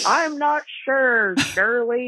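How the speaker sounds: put-on high-pitched, drawn-out old lady reply; imitated grandmother. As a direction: call it back slowly as grandma. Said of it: "My grandmother goes".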